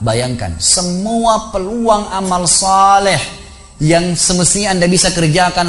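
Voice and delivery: high, drawn-out exhortation; impassioned preaching. Acted. Preacher urging the mock corpse to regret, impassioned and high. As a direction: press each regret home with sustained intensity.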